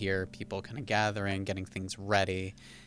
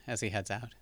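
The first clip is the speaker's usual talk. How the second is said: ordinary talk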